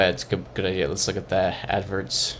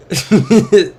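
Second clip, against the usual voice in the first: loud high laugh; 11 semitones higher, 10 dB louder than usual. Real, not acted. Streamer cracking up at a street sign, loud laugh.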